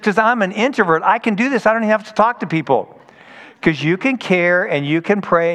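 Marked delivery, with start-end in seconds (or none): 0.0-3.0 higher, eager voice, speeding up at the end; excited girl. Retold or imitated. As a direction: play the eager introvert teen. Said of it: "She says".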